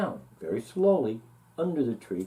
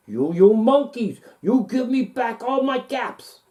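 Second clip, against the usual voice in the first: louder and higher, angry demand; angry peddler; 8 semitones higher, 8 dB louder than usual. Acted. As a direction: shake both hands and yell at them. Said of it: "This made the peddler angry".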